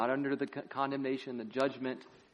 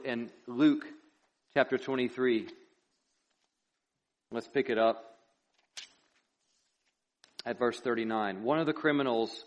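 ordinary talk

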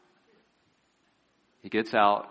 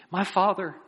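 high, humble appeal; gracious plea; 9 semitones higher than usual. Retold or imitated. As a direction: plead gently with your master. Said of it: "entreated him in a very gracious, humble way".

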